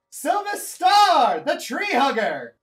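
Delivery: high, loud, slow showman call; booming ringmaster proclaims the performer; announcer introduction. Acted. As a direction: announce the act to the whole crowd.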